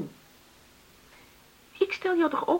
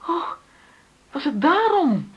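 incredulous; 7 semitones higher, 6 dB louder, slower than usual. Acted. Wife realising his reason.

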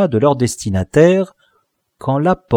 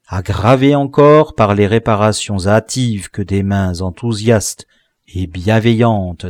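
plain reading voice

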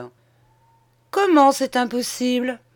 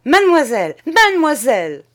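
loud and higher cry; loud, higher nun's cry to cover the maid; shouting over her; 6 semitones higher, 7 dB louder than usual. A narrator's reading. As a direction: drown out the other voice. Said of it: "couvrir ma voix de sa voix".

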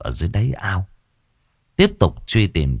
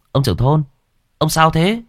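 higher-pitched worried call; alarmed concern; 9 semitones higher than usual. A narrator's reading. Villager to the shaken village chief, alarmed. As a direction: ask urgently, rising at the end.

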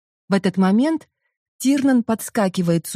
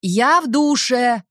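shouting; 4 semitones higher, slower than usual. A narrator's reading. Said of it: "наконец выкрикиваю я".

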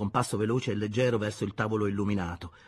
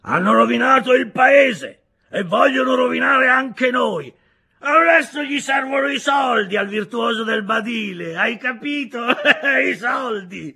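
put-on much higher and louder drunken ranting; drunken shouting rant, with a scornful laugh; raised voice; 14 semitones higher, 13 dB louder than usual. A narrator's reading. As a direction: bellow it at the whole barracks. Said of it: "Kostochkin alzò il tono di voce".

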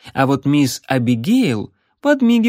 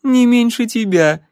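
high, giggly teasing retort; giggling; 10 semitones higher, 5 dB louder than usual. Acted. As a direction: giggle it back at him. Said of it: "хихикнула Эбби".

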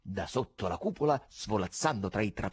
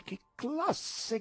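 much higher, delighted call; enraptured cry. A narrator's reading. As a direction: cry it out in rapture. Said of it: "gridavano rapiti".

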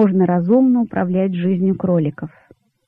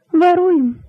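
much higher, plaintive confession; contrite; 10 semitones higher than usual. A narrator's reading. Rabbits confessing to the king, contrite. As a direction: own up ruefully in a lifted voice. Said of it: "сокрушённо отвечали кролики".

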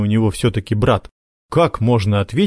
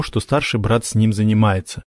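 ordinary delivery

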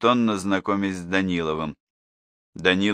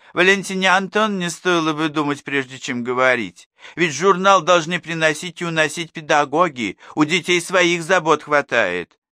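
chatty neighbour; 8 semitones higher, 3 dB louder than usual. A narrator's reading. Neighbour chats to Danilov.

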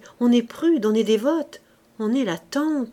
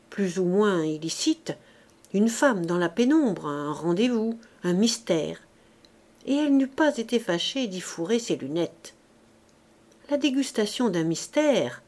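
ordinary delivery, nothing marked

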